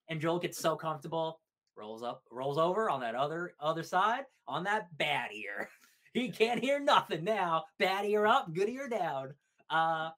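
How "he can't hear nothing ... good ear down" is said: higher, slower, relishing each word; playful slow glee; gleeful teasing. Real, not acted. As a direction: gloat about his mistake.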